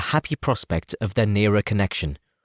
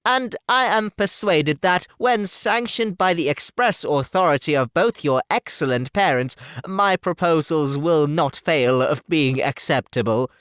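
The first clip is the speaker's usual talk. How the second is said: higher, slow, fumbling pompous suitor; a stiff clergyman playing the gallant, slow and higher; awkward gallantry; 7 semitones higher, slower than usual. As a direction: flatter her clumsily and self-importantly. Mr Collins continues his suit.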